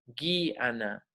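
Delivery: "Guiana" softly syllabled, hard g; Spanish-style pronunciation. Shown as a demonstration. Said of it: "Así se pronunciaría en español".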